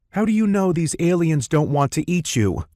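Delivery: high-pitched sharp retort; firing back. A narrator's reading. Tall woman challenging the warning about aliens, high retort. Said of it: "the tall woman fired back".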